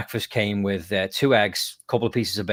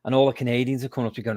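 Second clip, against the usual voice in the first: ordinary delivery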